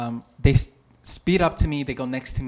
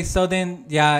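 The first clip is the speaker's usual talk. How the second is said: normal talking voice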